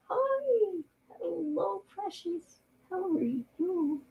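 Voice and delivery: sweet high pet voice for the cat. Real, not acted. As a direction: coo softly at a beloved kitten. Cooing to her cat.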